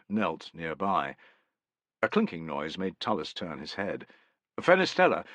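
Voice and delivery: ordinary delivery, nothing marked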